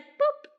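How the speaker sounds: tiny high-pitched boop; imitated boop. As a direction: pop out a cartoonish little boop. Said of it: "they would be like".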